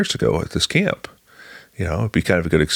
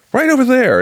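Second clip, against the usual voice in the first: high, louder, imitated eagerness; mock excited pointing-out; 5 dB louder than usual. Director shows visitor the chapel hill, loud high voice. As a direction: call it out high, showing the spot.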